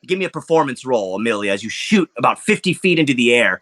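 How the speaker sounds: ordinary talk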